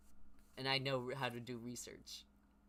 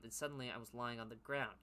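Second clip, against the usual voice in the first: ordinary delivery, nothing marked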